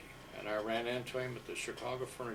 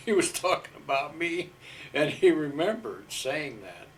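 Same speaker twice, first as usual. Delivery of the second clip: laughing through the words, higher and louder; 5 semitones higher, 8 dB louder than usual. Real, not acted. Veteran laughing at the sergeant's joke about him, laughing. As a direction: chuckle as you tell it. Laughing at the twelve-year-old remark.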